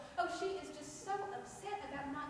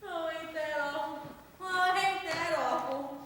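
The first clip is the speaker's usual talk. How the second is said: drawn-out, scandalized twang, louder the second time; shocked gossip; 3 semitones higher, 7 dB louder than usual. Acted. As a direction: savour the scandal, building. Reacting to gossip news.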